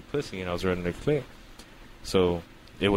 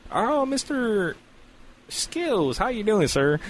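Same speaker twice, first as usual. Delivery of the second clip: high, slow, cheery doctor voice; mock cheery doctor voice; doctor's greeting; 12 semitones higher, slower than usual. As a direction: greet him sweetly and slowly. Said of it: "and he's like".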